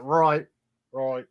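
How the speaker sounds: demonstrating a mock British "Right"; clipped-off catchphrase in fake British; British accent. Shown as a demonstration. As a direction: say "Right" with a broad British "roit" vowel. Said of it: "all you gotta do to do a British accent".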